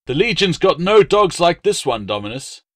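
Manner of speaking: a hint of a fawning salesman, quick on the opening; dog dealer pitching to a noble buyer; lightly voiced eager dealer. A narrator's reading. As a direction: sell hard, eager to please.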